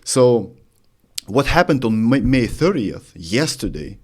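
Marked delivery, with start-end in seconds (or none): none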